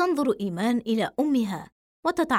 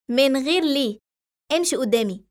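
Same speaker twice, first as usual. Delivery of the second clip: louder, a firm command; raised voice; 3 semitones higher, 3 dB louder than usual. A narrator's reading. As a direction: cut her off sharply. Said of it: "فيعلو صوت أمها". (بصوت عالٍ وحازم)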